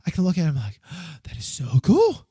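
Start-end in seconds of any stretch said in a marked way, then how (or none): 1.0-2.2 awed gushing. Retold or imitated. Fan acting out seeing the artwork, awed gushing. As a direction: act out being blown away.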